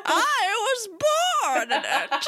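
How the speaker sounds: Bane impression. Teasing Tom Hardy's Bane accent, slow hammed-up Bane voice. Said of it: "the Bane accent, very bad".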